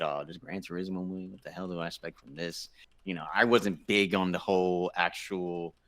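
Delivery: usual speaking voice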